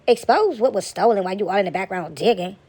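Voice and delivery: ordinary talk, nothing marked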